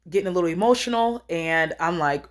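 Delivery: normal talking voice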